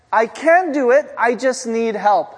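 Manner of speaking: put-on a kid proudly trying the new words; eager little kid. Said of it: "her kid's like".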